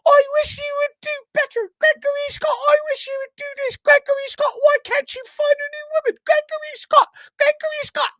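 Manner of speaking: very high, loud, shrill-pitched mother scolding; over-the-top high mom voice, half-shouted; nagging mom voice. Retold or imitated. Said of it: "it goes like this".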